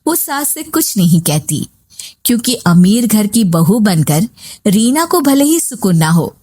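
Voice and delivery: plain reading voice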